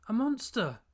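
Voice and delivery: high, loud scream of a word; terrified scream. A narrator's reading. Boy flees the monster baby screaming. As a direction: scream it as you flee.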